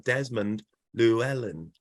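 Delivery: "Desmond Llewellyn" slowly, name by name; slow, careful. Shown as a demonstration. After failing to say it fast, "Desmond Llewellyn" slow and deliberate. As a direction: take your time over each part of "Desmond Llewellyn". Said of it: "have to take my time".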